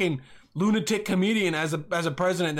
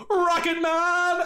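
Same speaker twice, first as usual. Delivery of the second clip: playful shout; 10 semitones higher, 3 dB louder, much slower than usual. Real, not acted. Podcaster joking about leaving the planet, shouting Rocket Man. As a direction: belt it out slowly, high.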